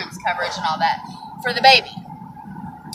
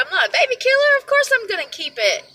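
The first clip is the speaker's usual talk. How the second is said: indignant; 11 semitones higher than usual. Retold or imitated. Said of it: "She said".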